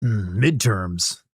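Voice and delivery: slow, drawn-out gruff soldier voice; slow, terse soldier voice; Solid Snake. Pretend. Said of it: "congratulate me and Solid Snake's voice".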